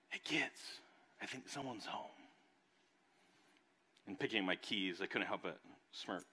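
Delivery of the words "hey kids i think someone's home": soft, lightly voiced soothing wife; a gentle hush toward the kids. Wife softly telling the kids someone's home, soothing. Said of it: "a very soothing voice".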